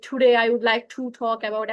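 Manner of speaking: slow, affected posh English accent; a put-on fancy English accent, drawn out slowly; mock posh English. Shown as a demonstration. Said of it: "ঠুট হার English বলতে হবে". (বানানো ঠুট হা ইংরেজি উচ্চারণ, ধীরে টেনে টেনে)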